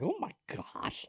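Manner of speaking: high, soft, slow "oh my gosh"; hushed, high-pitched amazement, retold; retold shock. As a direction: draw it out softly in mock disbelief. Retold shocked reaction to news.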